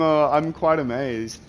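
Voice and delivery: normal talking voice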